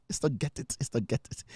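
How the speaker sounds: quietly correcting Dad, nearly under the breath; hushed child's correction. Retold or imitated. Daughter correcting dad, hushed murmur. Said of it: "No, no, no, Dad".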